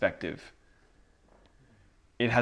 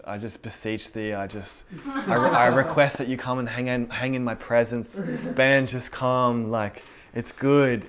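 hammed-up pious pleading, building louder; mock church prayer; 3 semitones higher, 4 dB louder than usual. Preacher mocking church prayer-speak on a friend, hammed-up piety. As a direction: parody a religious prayer addressed to a friend. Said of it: "start speaking this language that you heard church people".

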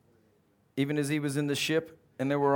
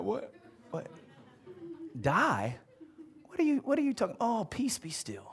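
drowsy, casual higher voice, fading off; groggy offhand Jesus; 7 semitones higher, 5 dB quieter than usual. Acted. Half-asleep Jesus brushing off the storm, drowsy. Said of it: "Jesus was still laying down".

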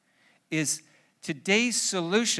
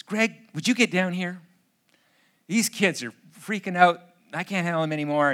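mock calling up the stairs, louder; retold holler; 3 semitones higher, 7 dB louder, faster than usual. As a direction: lightly imitate a wife hollering for help. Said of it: "she hollers for me".

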